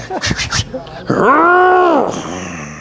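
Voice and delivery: straining laugh-shout. Real, not acted. Straining on the grip crusher.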